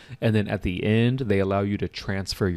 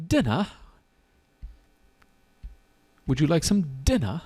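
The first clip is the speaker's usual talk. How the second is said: mock British accent, higher pitch on "dinner"; 영국식 발음 흉내; 6 semitones higher than usual. Shown as a demonstration. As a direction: playfully put on a British "dinna". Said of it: "like it's pronounced".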